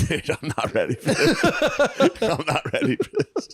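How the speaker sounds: words broken by laughter; laughing. Real, not acted. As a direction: laugh through the line. Laughing at a crude joke.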